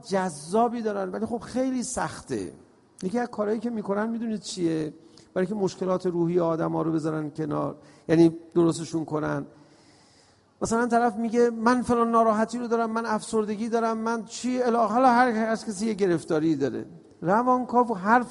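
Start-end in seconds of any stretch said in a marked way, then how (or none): none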